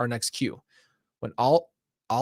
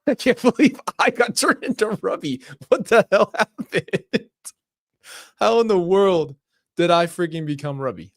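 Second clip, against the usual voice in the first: high, loud, stunned outburst; can't believe what just happened; loud high shock at becoming Rubby; shocked disbelief; 6 semitones higher, 7 dB louder than usual. Real, not acted. Shocked at being turned into Rubby.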